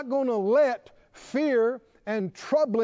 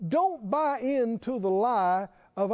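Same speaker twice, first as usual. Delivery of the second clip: ordinary talk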